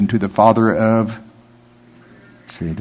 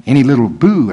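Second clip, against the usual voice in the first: usual speaking voice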